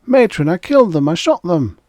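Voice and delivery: high, loud pleading; a prisoner begging to be believed; desperate confession. A narrator's reading. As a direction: blurt it out in panic. Frantic confession to the matron.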